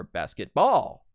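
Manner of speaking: basketball with BALL loud and high; stress on last syllable. Shown as a demonstration. Teacher shows how wrong stress sounds, basketball stressed on BALL. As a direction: slowly land hard on the BALL of basketball. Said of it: "it doesn't sound right".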